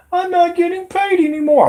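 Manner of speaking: high, mock whiny complaint; sarcastic imitation of a complaining creator; mocking whiner. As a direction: parody a sulky YouTuber.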